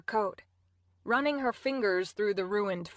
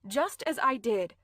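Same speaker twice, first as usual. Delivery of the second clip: ordinary delivery, nothing marked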